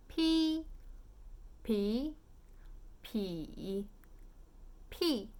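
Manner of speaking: "P" as "pee", level, rising, dipping, falling; four Mandarin tones. Shown as a demonstration. Teacher drills beer syllable for learners, four tones. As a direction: run "P" pronounced pee through four tones. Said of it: "let's do the full tones".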